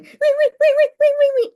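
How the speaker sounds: very high, loud repeated "wee"; imitated call-button noise. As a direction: squeal out a piercing buzzer imitation.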